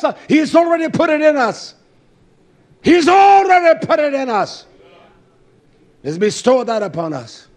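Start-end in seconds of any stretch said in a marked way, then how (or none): 0.0-1.7 shouted line. Real, not acted. 2.8-4.6 louder shout. Real, not acted. Repeated louder.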